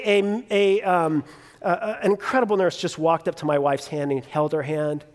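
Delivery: ordinary talk, nothing marked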